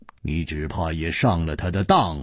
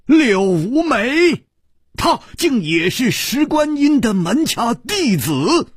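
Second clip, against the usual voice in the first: shocked disbelief; 5 dB louder than usual. Acted. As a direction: gasp the words out, stunned. 柳无眉惊闻真相. (柳无眉震惊地反问)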